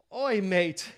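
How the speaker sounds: put-on Aussie greeting; mock Australian accent. Guy copying the Australian dude to impress her, mock accent. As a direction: try out a fake Australian accent. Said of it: "Same accent?".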